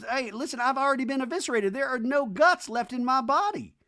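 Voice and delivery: high, pleading mock whine. JT pleads with Whitney in the van, high mock whine. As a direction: squeak it out like he's been gutted. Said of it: "And J T goes".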